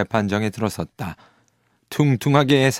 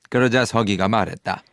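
plain reading voice

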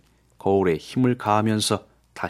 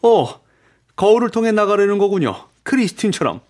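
high exclamation of dawning understanding; astonished realization; 11 semitones higher than usual. A narrator's reading. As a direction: gasp out the realization. Raoul suddenly realizes the mirror exit.

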